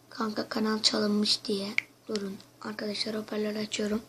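nefesli, sessiz fısıltı; arkadaşı duymasın diye fısılda. Real, not acted. Aramadan önce fısıldıyor. (telefon açılmadan önce izleyicilere fısıltıyla)

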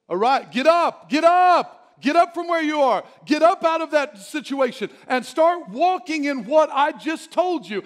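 loud, high, urgent command to get up; commanding shout. Acted. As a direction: call them to their feet with force. Preacher voicing God's command.

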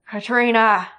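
loud cry with a burr of fear; fearful cry. Acted. Sloane crying out to his daughter in fear, loud. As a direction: call her name as you lose her. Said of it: "exclaimed Sloane with a burr of fear".

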